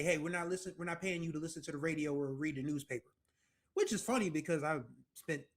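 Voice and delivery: ordinary talk, nothing marked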